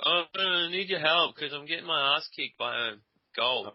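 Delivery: high, whiny mock plea for help; mocking whiner. Player mocks friend's cry for help, whiny and high. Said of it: "some little bitch was like".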